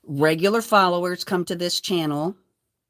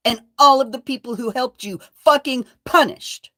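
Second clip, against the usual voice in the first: louder and higher, angry and insistent; angry outburst; 5 semitones higher, 5 dB louder than usual. Real, not acted. Addressing her harasser, angry and loud. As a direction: demand he be punished, voice rising.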